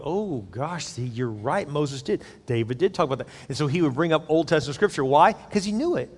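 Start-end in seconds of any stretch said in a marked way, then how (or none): 0.0-3.3 softer and lower, a hushed mock admission of being wrong; sheepish concession. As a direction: concede it quietly, caught out. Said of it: "They'd be like".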